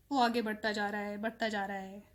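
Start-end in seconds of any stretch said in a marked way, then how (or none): none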